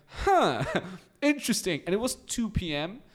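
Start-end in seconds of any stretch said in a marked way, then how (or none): 0.1-1.8 mock surprise. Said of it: "So I'm like".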